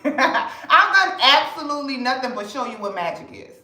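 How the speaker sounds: ordinary delivery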